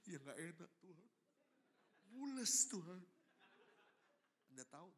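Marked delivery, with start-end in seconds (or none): 0.1-3.0 pleading complaint. Acted. Complains to God after being spat on.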